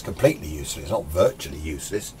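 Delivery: normal talking voice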